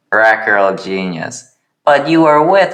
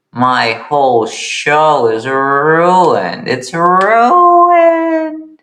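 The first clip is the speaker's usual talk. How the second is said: dramatic wail; 8 semitones higher, 3 dB louder, much slower than usual. Acted. Josh despairs over ruined show.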